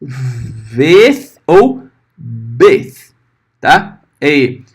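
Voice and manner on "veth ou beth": veth ou beth drawn out, v blown then b popped; teacher contrasting veth and beth slowly; slow letter names. Shown as a demonstration. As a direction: stretch veth ou beth, friction then pop. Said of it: "Por isso que eu vou ter".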